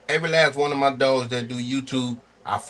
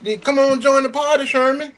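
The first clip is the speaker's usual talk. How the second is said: high, singsong dispatcher pitch; mock dispatcher call; 7 semitones higher than usual. Imitating a dispatcher calling a driver, high pitched pitch. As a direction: sell the load like a pushy dispatcher, pitched way up.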